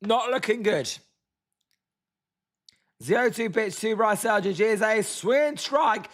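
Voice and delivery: ordinary talk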